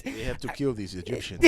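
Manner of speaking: laughing, loud mock French accent; Napoleon French accent. Joking Napoleon French impression.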